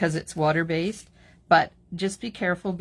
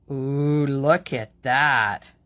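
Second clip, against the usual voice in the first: excited; 2 semitones lower, 8 dB louder, much slower than usual. Real, not acted. Admiring the result.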